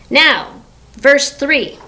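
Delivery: ordinary delivery, nothing marked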